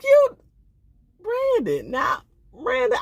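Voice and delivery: mock outraged shout; exasperated call-out. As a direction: yell his name in disbelief. Host calls out Brandon.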